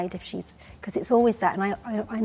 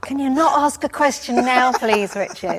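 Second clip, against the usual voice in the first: raised, louder mock telling-off; mock exasperation; 5 semitones higher, 6 dB louder than usual. Makeup artist telling off Richard during lip liner, mock exasperated. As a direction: plead in playful exasperation. Acting out a complaint to photographer.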